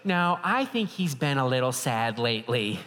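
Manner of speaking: lightly imitated wife contradicting; a touch of put-on wife voice; Tracy. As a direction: gently insist as the wife. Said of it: "And she goes".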